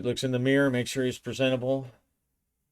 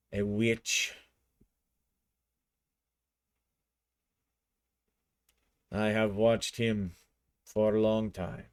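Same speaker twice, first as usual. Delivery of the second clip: gang father. A narrator's reading. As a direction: present him formally to the assembled family.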